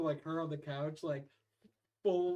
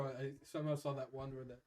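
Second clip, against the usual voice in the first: ordinary talk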